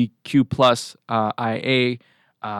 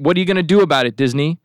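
normal talking voice

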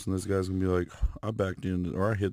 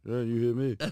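laughing mock echo; 3 semitones higher than usual. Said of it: "I'll be like".

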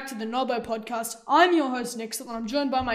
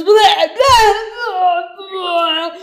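loud gagging blah blah; disgusted spluttering; 6 semitones higher, 11 dB louder, much slower than usual. Retold or imitated. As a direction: spit it out in disgust. Imitating disgusted spitting sound.

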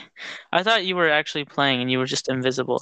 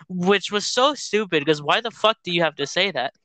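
normal talking voice

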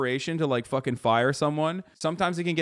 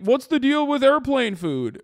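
high, loud hack comic voice; mock standup; 9 semitones higher, 5 dB louder than usual. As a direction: ham up a cheesy standup bit. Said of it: "the edgier version of saying".

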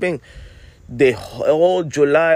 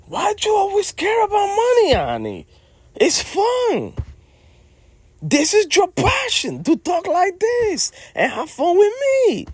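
high-pitched protest. Acted. Nagging Annie to have fun instead of chasing money, high-pitched protest. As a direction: voice shooting up high in exasperated protest.

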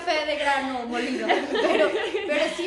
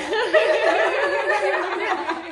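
louder laughter trailing down; laughing; 5 semitones higher, 6 dB louder than usual. Real, not acted. Laughs at friend's quip. (risa fuerte que se apaga)

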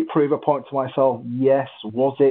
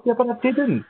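much higher, laughing retort; retold cheeky brush-off, pitched up; cheeky reply; 12 semitones higher than usual. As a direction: toss it back playfully. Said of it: "I said".

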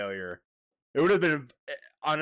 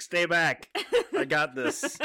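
mock heroic bird; 8 semitones higher, 4 dB louder, slower than usual. Voicing the bird, laughing.

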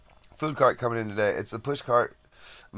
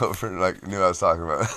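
usual speaking voice

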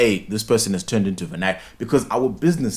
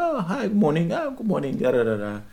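overly sweet greeting; 8 semitones higher than usual. Retold or imitated. Cheery phone greeting, retold.

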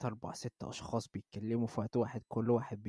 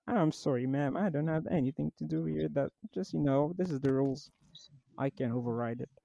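slightly higher Texan supervisor voice; changed voice; 4 semitones higher, slower than usual. Retold or imitated. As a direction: apologise firmly as the fake boss. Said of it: "مغير صوتي".

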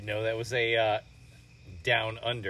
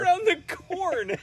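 high, slow, loud mocking repeat; mock the mistake in a silly voice; 7 dB louder, slower than usual. Mocking the wrong show title.

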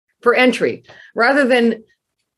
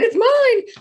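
mock claim.

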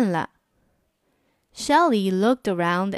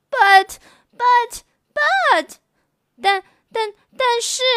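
put-on very high, halting little girl buts; a child trying to argue back; stammering protest; 13 semitones higher, 4 dB louder, slower than usual.

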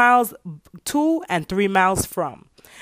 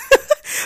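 high laugh; 4 dB louder than usual. Real, not acted. Laughs at own joke.

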